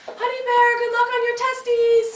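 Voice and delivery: high, loud sing-song cooing; wishing boyfriend luck on his exam, in cooing baby talk. Retold or imitated. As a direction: croon it out the car window. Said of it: "I'm like".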